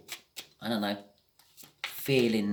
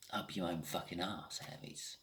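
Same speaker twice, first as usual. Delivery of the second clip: a muttered line in a slight regional twang, much softer; a hint of a put-on twang, dropped low; odd twang; 3 semitones lower, 10 dB quieter than usual. Reader teasing the Aries viewer, softly, with a slight twang. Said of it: "I've said it with this twang".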